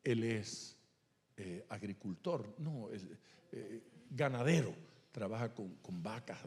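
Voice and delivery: ordinary talk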